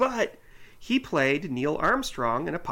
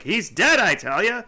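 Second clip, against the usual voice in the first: loud exclaiming; 8 semitones higher, 8 dB louder than usual. Retold or imitated. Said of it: "who's like".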